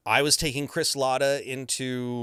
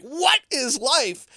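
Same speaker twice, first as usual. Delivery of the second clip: much higher, slow, drawn out; stunned disbelief; 6 dB louder, much slower than usual. Retold or imitated. Fan learning his director is Apollo Creed, stunned. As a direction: marvel as if the world just flipped. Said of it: "I'm like".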